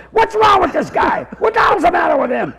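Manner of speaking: imitated high-pitched outrage; irate yelling. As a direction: complain loudly down the phone, indignant. Old man yells down the phone.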